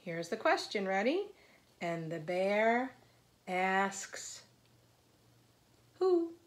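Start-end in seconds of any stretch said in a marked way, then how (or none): 1.8-6.3 put-on read-aloud to children. A narrator's reading. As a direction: lift the characters' questions above the narration. Reads a picture book aloud.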